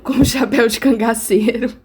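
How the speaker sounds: giggling as she says it; words squeezed through laughter; laughing through words. Real, not acted. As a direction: say it while cracking up at the image. Laughing at her own mental image.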